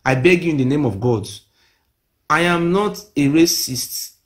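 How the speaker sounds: ordinary talk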